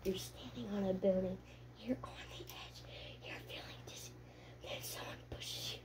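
hushed, eerie whisper; barely audible breath of a voice. Pretend. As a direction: whisper it like a spooky guided game. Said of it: "How did the whispering feel".

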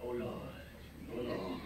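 slow, low, drawn-out oh Lord; mock groaning prayer. Preacher mocking pray-ers, low drawn-out oh Lord. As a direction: imitate a lazy groaning pray-er. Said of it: "just start and say".

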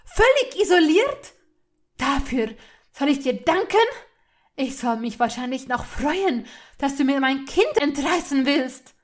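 loud, high, indignant questions; incredulous outrage. A narrator's reading. Hermine told to thank her captor Malfoy, incredulous outrage. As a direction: rail at him in scornful disbelief.

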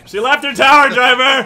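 excited. Real, not acted. Laughing outburst at kill.

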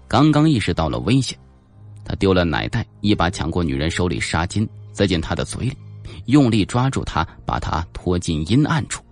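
usual speaking voice

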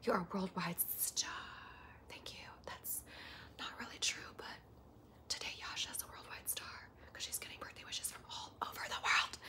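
very soft, voiceless whisper; hushed ASMR thanks. Pretend. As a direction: whisper intimately into the mic. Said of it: "Please do A S M R again".

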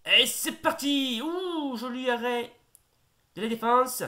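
high, loud goal call building to a shout; excited sports commentary. Acted.

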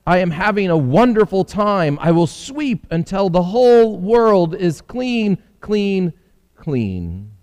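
high, gleeful little housefly voice. Acted. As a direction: sweep away happily like a busy fly. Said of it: "said the housefly".

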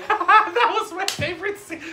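said through a high laugh; laughing through the words. Real, not acted. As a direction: laugh while you say it. Laughing at the memory.

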